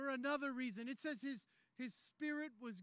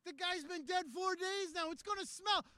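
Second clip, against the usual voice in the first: higher, louder, emphatic pulpit delivery; rousing preaching climax; 6 semitones higher, 5 dB louder than usual. Acted. Preacher promising God will do the heavy lifting, rousing and forceful. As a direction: drive it home to the whole room.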